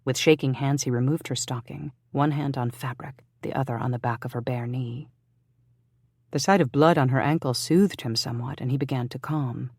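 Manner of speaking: plain reading voice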